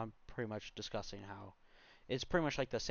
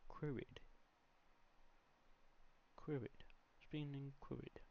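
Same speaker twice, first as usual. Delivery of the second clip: soft, higher British-sounding voice; mock British; 4 semitones higher, 12 dB quieter than usual. Tutorial host toying with a word that sounds British, mock British accent. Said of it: "sounds kind of British".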